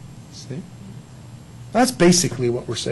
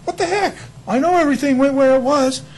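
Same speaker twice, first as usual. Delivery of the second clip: high, bewildered what the heck; sudden high disbelief at the crooked shelf; baffled; 11 semitones higher, 4 dB louder than usual. Retold or imitated. Preacher retelling his shelf mishap, baffled and high.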